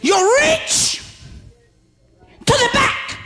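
high, shouted, slowed preaching; preaching at full force. Real, not acted. Preacher urging the congregation to reach back, full-force preaching. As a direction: let it ring out over the church. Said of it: "why am I speaking like this today".

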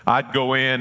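normal talking voice

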